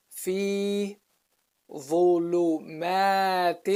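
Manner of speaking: measured, separated words with held vowels; Quran recitation. Shown as a demonstration. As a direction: recite each word out for learners. Teacher recites the verse.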